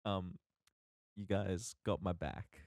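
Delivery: ordinary talk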